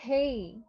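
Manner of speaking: হেই with a clear e-to-i glide; correct pronunciation. Shown as a demonstration. Teacher models the word hay. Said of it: "H A Y".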